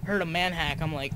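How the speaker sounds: ordinary talk, nothing marked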